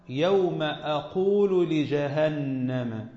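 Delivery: أقول recited very slowly, starting with a glottal a; reading with hamza. Shown as a demonstration. Teacher recites al-Hasan's reading, very slowly. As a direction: draw out يوم أقول in slow recitation. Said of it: "وقرأ الحسن".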